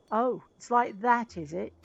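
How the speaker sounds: loud, slow affronted retort; affronted engineer. Acted. Engineer learns he is outbid, loud and affronted. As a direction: draw it out, stung and put out.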